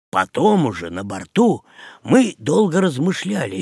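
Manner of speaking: ordinary delivery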